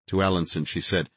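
plain reading voice

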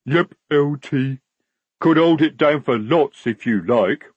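simple, willing troll recruit; obliging troll. Acted. Troll private answering the lieutenant, obligingly simple. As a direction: answer the officer as an eager troll.

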